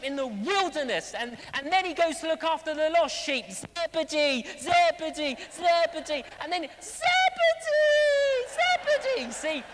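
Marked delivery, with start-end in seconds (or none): 3.5-6.2 repeated searching call. Acted. Shepherd searching, calling the sheep. 6.8-9.3 very high, long-drawn holler; calling from afar. Acted. Shepherd calls out across the hills.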